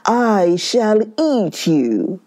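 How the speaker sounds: lightly voiced growling tiger; a hint of a growling tiger. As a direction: menace him like a hungry tiger. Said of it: "growled the tiger".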